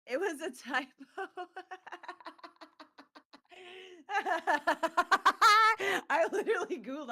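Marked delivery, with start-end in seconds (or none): 0.9-6.0 loud high burst of laughter; cracking up hard; big laugh. Real, not acted. Laughing at the typo. 6.1-7.1 laughing. Real, not acted. Laughing while telling.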